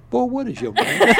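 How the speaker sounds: laughing quote. Retold or imitated. Drunk governor forgets son's name.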